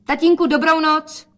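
high, loud goodbye; calling out. A narrator's reading. Daughter says good night to father, calling out. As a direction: call the farewell out high.

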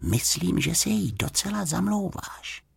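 soft, high confiding voice; hushed girlish reassurance; whispering. A narrator's reading. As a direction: confide it quietly to a friend. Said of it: "zašeptala".

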